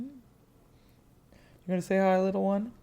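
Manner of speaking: high pet-talk voice, drawn out; cooing to a cat. Real, not acted. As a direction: coo softly at the little cat. Talking to the cat.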